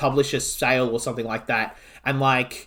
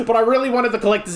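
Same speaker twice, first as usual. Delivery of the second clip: laughing; 7 semitones higher, faster than usual. Real, not acted. Laughing at own extravagant purchase.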